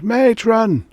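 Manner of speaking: high, loud desperate scream; frantic cry for the matron. A narrator's reading. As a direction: scream for help in panic. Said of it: "she screamed".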